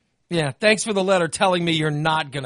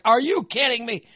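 incredulous; 7 semitones higher than usual. Retold or imitated. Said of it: "and I'm like".

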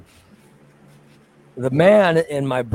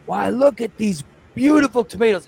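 higher, louder boastful show-off voice; proud neighbor showing off; 6 semitones higher, 5 dB louder than usual. Retold or imitated. Mimicking the neighbor showing guests his tomatoes, proud and loud. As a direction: beam over the tomatoes like a proud gardener. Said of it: "And he'd go".

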